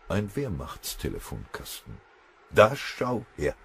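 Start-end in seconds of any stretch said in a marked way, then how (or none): 2.5-3.6 slow, higher note of surprise; surprised. A narrator's reading. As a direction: draw it out at an unexpected find. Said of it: "sagte Doll überrascht". (überrascht, gedehnt)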